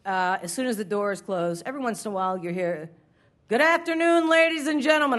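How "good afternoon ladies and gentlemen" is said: high, loud formal announcement; subway announcer pitch. Retold or imitated. Imitating the subway panhandler's opening, high and loud. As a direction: boom it like a subway car speech. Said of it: "it's that tone, that pitch".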